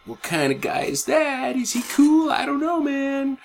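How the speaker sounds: high, mock dopey musing; dopey mermaid thoughts. Host voices the mermaid sizing him up, hammed-up dopey musing. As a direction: voice her as a clueless girl. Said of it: "giving the old".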